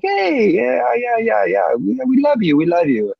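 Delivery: high-pitched, gushing mock welcome; put-on cheery chatter, all smiles; smiling fake friendliness. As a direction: imitate an over-friendly sales greeting with a smile. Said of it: "they're smiling and they're like".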